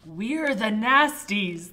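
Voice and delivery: loud, slow monster growl. Acted. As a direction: growl it to scare him. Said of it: "they growled".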